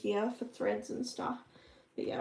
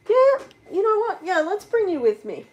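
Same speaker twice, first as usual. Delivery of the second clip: sudden burst of excitement, higher and louder; excited; 7 semitones higher, 8 dB louder than usual. Real, not acted. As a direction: announce the decision with enthusiasm. Suddenly decides to bring viewers.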